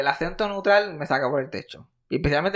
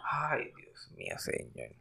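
exasperated groan; 15 dB quieter, slower than usual. Real, not acted. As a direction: mutter it like you can't bear it. Groaning at school gift swaps.

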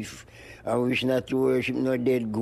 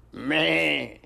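drawn-out, higher goat's "meh"; goat bleat; 3 semitones higher than usual. Retold or imitated. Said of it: "go meh".

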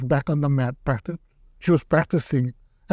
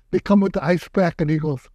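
ordinary talk

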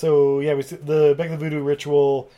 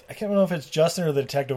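normal talking voice

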